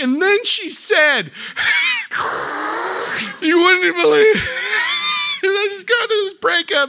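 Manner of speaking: loud high wailing mock cry; hammed-up sobbing. Customer oversharing a breakup to the cashier, comic sobbing. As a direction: bawl it out in an exaggerated, high-pitched voice.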